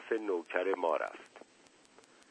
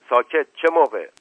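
high, sharp shout; loud interruption; 5 semitones higher, 9 dB louder than usual. A narrator's reading. Officer cutting short the servant's oath, shouted question. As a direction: cut the answer off loudly.

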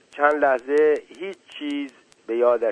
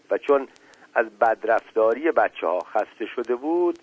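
ordinary delivery

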